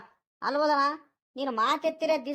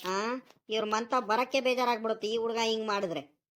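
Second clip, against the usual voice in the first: usual speaking voice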